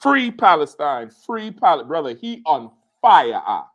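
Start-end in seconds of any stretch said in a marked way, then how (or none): 0.0-1.8 raised, drawn-out mock shout; retold yelling. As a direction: imitate a protester yelling it repeatedly. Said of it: "and started yelling".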